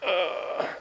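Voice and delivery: high, drawn-out yawning groan; big yawn. Shown as a demonstration. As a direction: yawn it out like you're tired. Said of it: "pretend you're tired and you just go".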